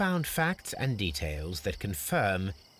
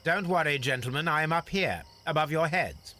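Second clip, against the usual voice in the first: raised voice carried down from above; calling down; 6 semitones higher, 3 dB louder than usual. Acted. Holmes calling down to the gentlemen from atop the wall. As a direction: call down to the men below, a touch higher.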